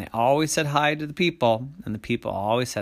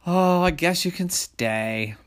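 higher, slow, drawn-out giving in; grudging consent; 7 semitones higher, 4 dB louder than usual. A narrator's reading. Monsters letting the people stay, reluctant consent. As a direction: relent slowly with a raised voice.

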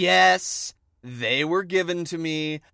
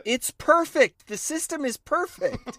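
smug capitalist voice; 11 semitones higher, 3 dB louder than usual. Pretend. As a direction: gloat like a true believer. Playing a proud capitalist.

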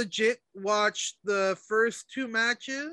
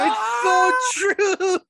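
high, loud, slow, laugh-crying; laugh-crying repeat of the meme; 11 semitones higher, 7 dB louder, much slower than usual. Real, not acted. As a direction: stretch it out while cracking up.

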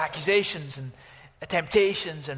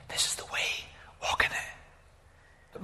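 hushed, drawn-out whisper; still small voice; 5 dB quieter, much slower than usual. Acted. Preacher voices God's guidance from behind you, whispered. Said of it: "the still small voice".

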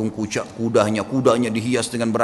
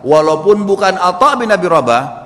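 stern warning; 5 semitones higher, 6 dB louder than usual. Acted. Father scolds son's careless words.

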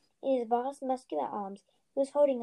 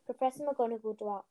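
plain reading voice

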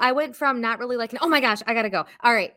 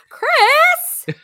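dismayed cry; 11 dB louder than usual. Real, not acted. Dismayed at sad lyric.

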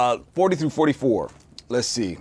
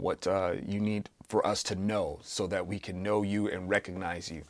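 soft, earnest prayer voice; 3 semitones lower, 4 dB quieter than usual. Acted. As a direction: lead the group in quiet prayer. Leads an opening prayer.

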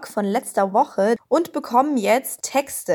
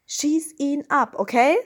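ordinary talk, nothing marked

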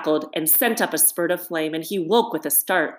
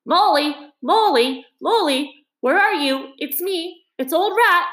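high, loud cheerful calling through the wood; calling out cheerfully; 11 semitones higher, 4 dB louder than usual. A narrator's reading. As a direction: shout cheerily to find a lost friend. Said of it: "calling out cheerfully".

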